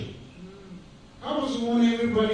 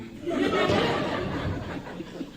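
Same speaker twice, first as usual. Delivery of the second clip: a high airy laugh at his own joke; high breathy laugh; 13 semitones higher, 6 dB quieter than usual. Real, not acted. Speaker laughs to the audience at his father's words, high airy laugh.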